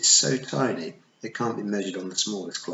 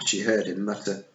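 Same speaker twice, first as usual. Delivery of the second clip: ordinary delivery, nothing marked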